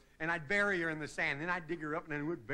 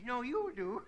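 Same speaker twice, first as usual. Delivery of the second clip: put-on kid's retort; 5 semitones higher, slower than usual.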